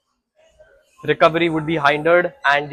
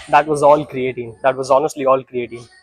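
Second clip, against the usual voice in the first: ordinary talk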